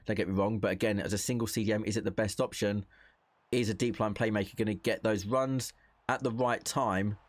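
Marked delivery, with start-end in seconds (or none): none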